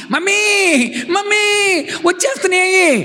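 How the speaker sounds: put-on high, frightened child crying for mummy; a little girl panicking as bees chase her; scared child. Child calling mummy as bees chase her, high and scared.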